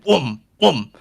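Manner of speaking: two loud whooms; heavy, falling whoom sounds; imitated coffin noise. Coffin right behind Tyler, loud whooming.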